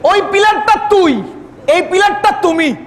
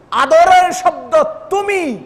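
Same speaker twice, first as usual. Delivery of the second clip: ordinary talk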